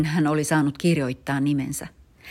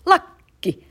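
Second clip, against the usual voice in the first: triumphant cry; 5 semitones higher, 7 dB louder, slower than usual. Acted. Dad rejoices at found cap.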